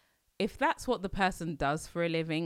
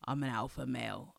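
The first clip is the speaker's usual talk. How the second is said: lower, softer mock boast; mocking alpha; 8 semitones lower, 8 dB quieter than usual. Mocking a man calling himself alpha male. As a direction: mimic a smug man. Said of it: "refers to himself as an alpha male".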